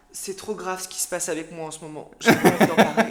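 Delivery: mock dramatic attention seeker. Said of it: "comme tous ces pique-me sur Facebook".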